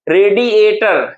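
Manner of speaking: "रेडिएटर" slowly, syllable by syllable; syllable breakdown. Shown as a demonstration. Teacher showing where stress falls, "रेडिएटर" slow and drawn out. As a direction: stretch out each part of "रेडिएटर". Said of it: "stress किस पर लगा है".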